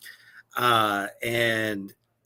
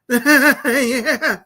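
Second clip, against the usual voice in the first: laughing yeah; 12 semitones higher, 9 dB louder than usual. Retold or imitated. Answering Lamb of God singer eagerly.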